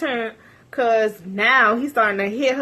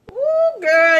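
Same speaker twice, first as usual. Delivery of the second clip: excited squeal; 9 semitones higher, 5 dB louder than usual. Real, not acted. Reacting to the gossip, excited squeal. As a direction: gasp it with delight.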